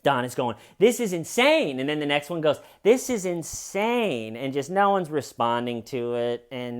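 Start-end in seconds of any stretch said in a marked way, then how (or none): 0.8-1.8 high, loud imitation of her outcry; mock outrage. Acting out Donna's unanswered outcry. 2.8-4.4 high, drawn-out imitation of her outcry; mock outrage. Donna's outcry repeated, nobody responds.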